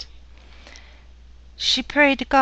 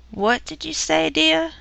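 sharp mother-in-law; 6 semitones higher than usual. Acted. Mother-in-law sharply questioning Nellie.

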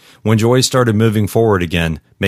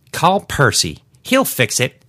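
put-on high, slow haughty old woman; a put-out old lady threatening; indignant old lady; 7 semitones higher than usual. Shelley's voice.

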